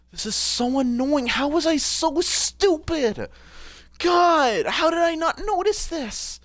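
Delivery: high-pitched, drawn-out self-reproach; exasperated wail. Real, not acted. Gamer annoyed at their own oversight, high exasperated wail. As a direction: whine it high, dragging out the key words. Berating self over missed detail.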